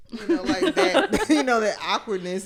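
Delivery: high, loud giggling through the words; laughing. Real, not acted. Laughs mid-sentence.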